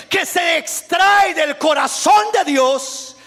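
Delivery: loud, high fervent preaching; preaching at full force. Real, not acted. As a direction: build the fire of the sermon. Predica a toda voz. (predicación fervorosa, fuerte y aguda)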